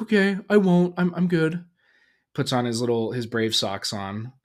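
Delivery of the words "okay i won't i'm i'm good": put-on high kid voice, eager to reassure; agreeing young son. Son promises dad he won't get scared, high child voice. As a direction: imitate a little boy promising he's fine. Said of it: "And he's like".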